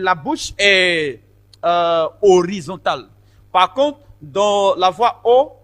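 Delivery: usual speaking voice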